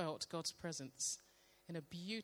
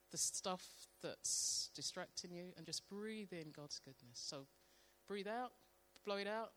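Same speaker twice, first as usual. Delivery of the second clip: guided meditation; 4 dB quieter than usual. Acted. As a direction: guide them gently into stillness. Leads a guided breathing prayer.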